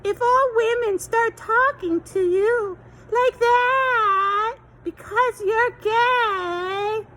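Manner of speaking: put-on high, slow, loud babyish whine; mock baby talk. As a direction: stretch it out like cooing at a toddler.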